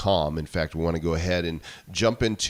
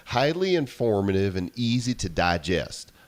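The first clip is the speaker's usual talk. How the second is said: mock Southern accent; 3 semitones higher than usual. Shown as a demonstration. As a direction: read "Highly informative" with a trucker's Southern twang. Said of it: "read this like I think he sounds".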